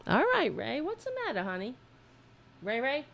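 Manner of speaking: high, soft sing-song talk to a pet cat. Real, not acted. Talking to his cat Ray Ray, soft high cooing baby talk.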